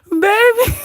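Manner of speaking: high squealing laugh into the word; helpless laughter. Real, not acted. Streamer worn out by the scandal, helpless laughter. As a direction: wheeze it out, cracking up.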